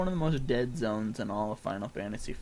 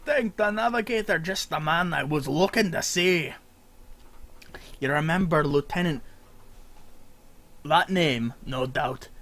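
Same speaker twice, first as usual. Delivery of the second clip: hearty pirate-Scottish sailor greeting, louder; NPC voice; 4 semitones higher, 7 dB louder than usual. Acted. Streamer voicing a sailor NPC greeting the player, hearty accent. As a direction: boom out a welcome like a ship's mate. Reading NPC dialogue aloud.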